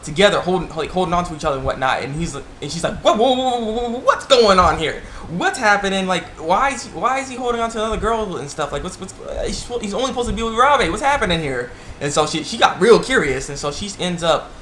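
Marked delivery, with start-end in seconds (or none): 3.0-11.7 mock surprise. Oka shocked, voiced.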